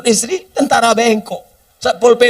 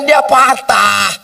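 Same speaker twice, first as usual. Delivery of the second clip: ordinary delivery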